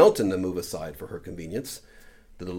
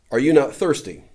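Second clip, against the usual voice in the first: a louder, higher lion's challenge; 5 semitones higher, 7 dB louder than usual. A narrator's reading. Aslan asks Jill at the stream, louder lion voice. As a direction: call out like the great lion. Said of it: "said the lion".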